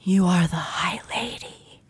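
hushed, breathy whisper; an eerie whispered murmur; soft echoing dream voice. Acted. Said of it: "I'll do the not Snooki".

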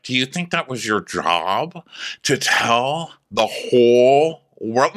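slow, swelling mock accusation; Taylor. Confronting someone for telling everyone, slow swooping drawl. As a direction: stretch it out, swooping on each phrase. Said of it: "where Taylor's like".

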